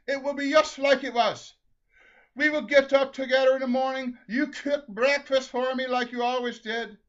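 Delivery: high, loud, exuberant listing; excited gushing. Acted. Husband plans their life together in heaven, loud excitement. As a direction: pour it out at full voice.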